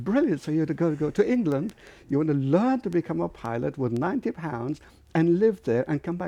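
high, loud mock outrage; retold angry tirade. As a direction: tear into your son's plan, high and incredulous. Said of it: "He was cursing and swearing".

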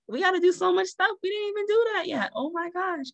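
high-pitched flustered exclamation; mock panic. New mother telling how unprepared she was, in high mock panic. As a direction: play up being overwhelmed, very high.

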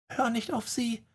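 put-on high, hushed girl's whisper; soft, high whispered plea; whispered warning. A narrator's reading. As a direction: breathe it out softly, high and urgent. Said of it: "flüsterte Emma".

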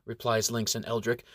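plain reading voice

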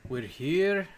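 put-on playful Scottish accent, We're here. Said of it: "I'm speaking in a Scottish accent again".

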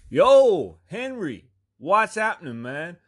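high, booming imitated greeting; calling out. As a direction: holler to the clerk on walking in. Buddy calls out to store clerk.

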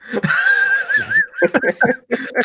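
high, helpless laughing fit; burst of laughter. Real, not acted. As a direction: crack up, can't stop laughing. Host cracks up at joke.

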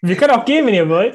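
high, loud mock huff; mock offended. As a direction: pretend to be left out. Teasing the two friends.

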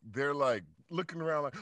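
usual speaking voice

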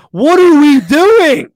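high, slow, loud disbelieving yell; exasperated shout. Real, not acted. Peak of rant about celebrations.

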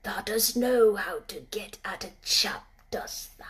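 soft awed whisper. Acted. Ben not wanting to scare the robin, soft whisper. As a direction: breathe it so the bird stays. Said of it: "as softly as if".

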